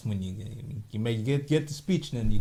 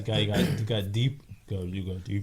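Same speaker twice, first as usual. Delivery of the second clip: deep seductive voice; 9 semitones lower, 5 dB quieter than usual. Shown as a demonstration. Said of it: "The seductive".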